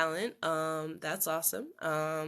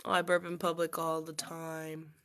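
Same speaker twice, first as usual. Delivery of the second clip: ordinary talk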